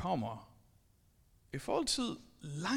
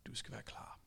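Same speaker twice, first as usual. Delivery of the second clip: whispered, softer warning; hushed warning; 9 semitones lower, 16 dB quieter than usual. Acted. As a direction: whisper it ominously.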